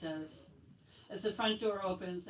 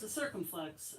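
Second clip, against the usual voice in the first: usual speaking voice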